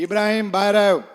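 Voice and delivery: loud call from outside the house; calling out loudly. Retold or imitated. Pathan shouts outside Ibrahim's house, a loud call. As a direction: shout his name through the door. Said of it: "બહારથી રાયડું નાખી".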